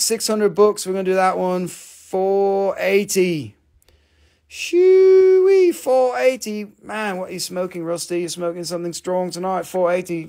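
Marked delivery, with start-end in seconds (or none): 4.5-5.7 high, loud "shooey" whistle of surprise; a much higher, louder exclaimed "shooey"; whoop. Real, not acted. Reacting to own low price.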